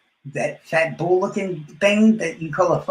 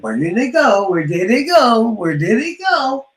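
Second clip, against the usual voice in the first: mock bafflement; 6 semitones higher, 6 dB louder than usual. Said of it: "I said".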